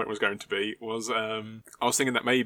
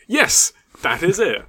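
loud, high burst of agreement; slow, emphatic yes of sudden recognition; that's exactly the one!; excited recognition; 8 semitones higher, 10 dB louder, slower than usual. Real, not acted. Recognizes the show just named.

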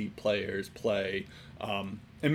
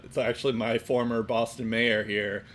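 ordinary delivery

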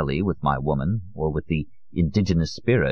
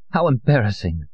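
slightly higher, embarrassed woman's voice; a touch of shame, pitched up; mild distress; 5 semitones higher than usual. Acted. Amparo ashamed after her trance.

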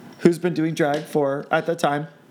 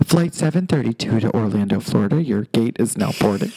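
low announcer over a PA; airport announcement; 8 semitones lower than usual. Pretend. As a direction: call the boarding like an airport PA.

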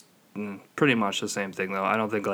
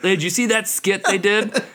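pitched-up mocking fan voice; clueless fan; 9 semitones higher than usual. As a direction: mimic an eager dimwit asking. Mimics clueless skit fans.

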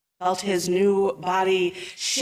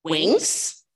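plain reading voice

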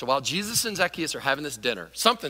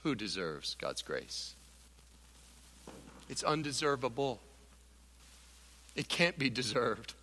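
hushed solemn; 5 semitones lower, 11 dB quieter than usual. Real, not acted. Solemn hush after climax.

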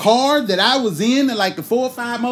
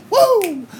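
high whoop; 12 semitones higher, 5 dB louder than usual. Retold or imitated. Singing on the bus.

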